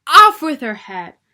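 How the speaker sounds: very loud, high furious scream; furious Queen yelling at Alice. A narrator's reading. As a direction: scream the order in rage. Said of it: "she yelled at Alice".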